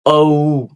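ঔ said as a rounded gliding ou; rounded O sound. Shown as a demonstration. Teacher showing the correct O sound. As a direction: round the lips into ঔ. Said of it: "ও, not".

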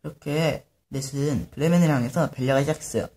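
plain reading voice

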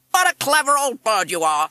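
high, very loud squawked echo; parrot mimic. A narrator's reading. Parrot repeating the mother's praise, squawky mimic. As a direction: squawk the praise back loudly.

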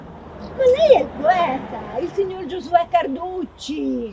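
loud, high, starstruck greeting; delighted recognition. Acted. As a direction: exclaim on suddenly recognizing a famous poet. Meets the famous poet unexpectedly.

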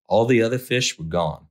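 ordinary delivery

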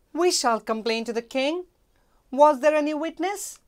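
high, loud royal voice; decide to go to the king; commanding queen. Acted. Queen resolving to complain to the king, high and loud.

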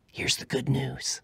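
sudden secret whisper. Real, not acted. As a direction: lean in and whisper it.

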